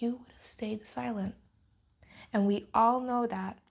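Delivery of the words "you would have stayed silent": bitter whispered aside. Real, not acted. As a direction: hiss it under your breath. Pointed aside in angry rant.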